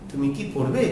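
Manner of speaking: brief "কি" with a soft rise on "পড়বে"; softly asked yes-or-no question, "কি" unstressed; short কি. Shown as a demonstration. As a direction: keep "কি" short, lift "পড়বে". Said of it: "ছোট করে দ্রুত উচ্চারণ করতে হবে".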